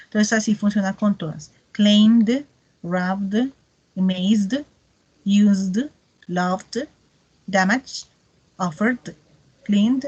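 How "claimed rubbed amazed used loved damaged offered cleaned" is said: "Claimed", "rubbed", "loved" each finished on an audible voiced "d"; D final bien audible; clear d ending. Shown as a demonstration. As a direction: land the final "d" clearly on every verb. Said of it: "suenan más fuerte la D".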